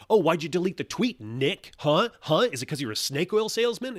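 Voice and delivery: loud, high-pitched taunting; needling, hectoring questions thrown out loudly; mock badgering. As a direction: imitate an accusing online mob. Mimics hostile Twitter mob badgering Nick.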